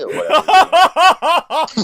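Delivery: laughing. Real, not acted. Laughs at own creepy impression.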